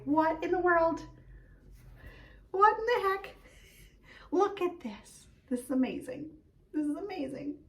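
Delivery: rising astonished what-in-the-heck, fading to soft awe; mock amazement, high then hushed; retelling her own amazement at a gift; amazed. Retelling amazed reaction to parcel.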